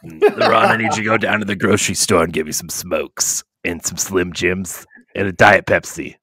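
lightly put-on smoker voice; mock heavy smoker. Smoker sends cohost for smokes, lightly put-on voice. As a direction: order an errand in a smoker character.

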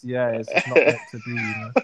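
ordinary delivery

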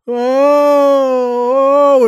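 high, loud swooning oh; hammed-up faint, pitch way up; fainting moans. Nun spinning before fainting, high loud moaning oh. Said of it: "she spins a little".

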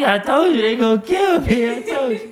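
put-on very high, loud kid's protest; hammed-up frantic pleading; panicked protest. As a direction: squeal it like a frightened kid. Child protesting he'd be killed.

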